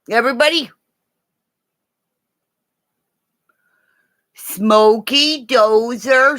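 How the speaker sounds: high, bright call to the pups; calling the dogs. Acted. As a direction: sing out to the dogs like it's treat time.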